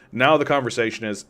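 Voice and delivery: usual speaking voice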